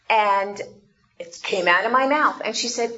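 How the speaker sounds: normal talking voice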